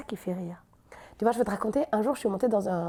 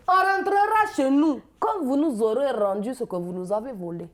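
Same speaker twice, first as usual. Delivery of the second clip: slow, loud Ivorian retort, dropping at the end; drawn-out, indignant Ivorian comeback; exuberant Ivorian accent; 3 semitones higher, 4 dB louder than usual. Acted. Ivorian woman told to go home retorts, exuberant Ivorian accent. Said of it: "elles sont très exubérantes".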